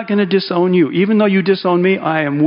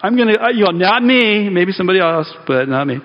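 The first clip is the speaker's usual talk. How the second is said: emphatic Peter; 4 semitones higher than usual. Retold or imitated. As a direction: puff up and insist not me. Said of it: "he's even more emphatic".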